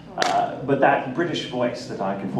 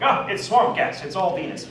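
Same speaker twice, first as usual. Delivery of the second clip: high, slow dismissive explaining; mock skeptic; 7 semitones higher, 4 dB louder than usual. Actor imitating a skeptic explaining away ghosts, mock dismissive. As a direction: put on a debunker waving it away.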